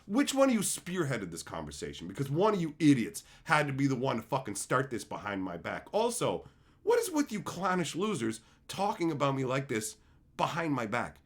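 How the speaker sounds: ordinary talk, nothing marked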